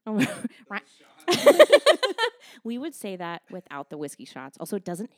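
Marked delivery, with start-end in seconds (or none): 1.3-2.6 loud squealing laugh; high burst of laughter. Real, not acted. Laughs at sappiness.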